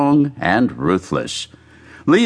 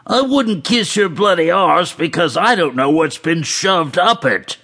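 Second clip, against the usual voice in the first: higher put-on voice, taunting; mocking soccer fan; 7 semitones higher than usual. A narrator's reading. Man behind retorts to the fan in front, high acted taunt. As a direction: jeer back in a high character voice.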